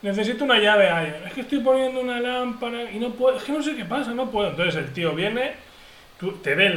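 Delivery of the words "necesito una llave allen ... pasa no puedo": high, whiny helpless voice breaking into a laugh; mock helplessness. To the handy neighbour, a hammed-up high helpless whine. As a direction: play the hammed-up useless neighbour.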